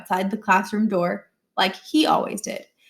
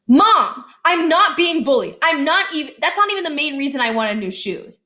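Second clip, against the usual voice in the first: higher, louder insistence that he's fine; exasperated protest; 7 semitones higher, 4 dB louder than usual. Acted. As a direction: push back hard at an overworried mom. Miles protesting loudly to mom.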